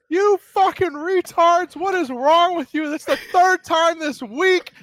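high, loud furious yell from the back room; furious manager yelling. Retold or imitated. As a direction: retell the boss screaming at her staff. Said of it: "all you hear is".

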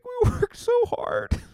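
very high, wailing mock sob; mock crying. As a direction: whimper it like a crybaby player. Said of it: "I would be crying on the bench".

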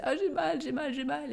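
high-pitched mock moaning; retold pain complaint. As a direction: replay a labour complaint, voice lifted high. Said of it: "À un moment, je disais". (plainte de douleur rejouée, voix aiguë)

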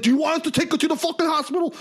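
high, rapid exasperated demand; frustrated. Retold or imitated. Ambulance guy asking the friends, frustrated. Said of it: "gets frustrated".

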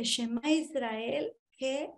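quiet, slow aside of amazement; stunned hush. Retold or imitated. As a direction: breathe it out slowly in shock. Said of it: "Y yo dije".

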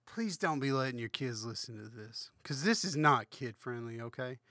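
normal talking voice

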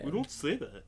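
playful echo of a Southern scold; mock Southern imitation. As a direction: mimic it in a mock Southern accent. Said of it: "he sounded like".

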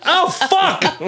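very high mock yelp; comic high-pitched cry of disgust; horrified realisation. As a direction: replay the sudden horror, hammed up. Retold moment of disgusted realisation.

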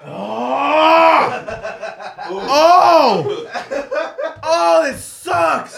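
pained shouting. Real, not acted. Pain from eating hot chip.

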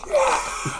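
high-pitched celebratory cheer; crowd cheer. Acted. Feasting crowd greets the son's homecoming, cheering. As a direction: cheer like a whole hall at once. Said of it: "like, they're all cheering".

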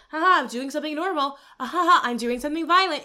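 lightly hammed-up ha-ha character voice; mock movie characters. Podcaster mocking mobsters acting normal then violent, mock chuckling.

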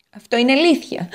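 an eager little potato telling her woes; Potato character voice. Acted. Potato agrees.

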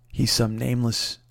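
ordinary talk, nothing marked

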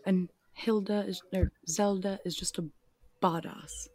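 put-on British, dropping soft at the end; playful British, hushed final word; British accent. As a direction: admire her in mock British. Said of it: "rest of the podcast in British".

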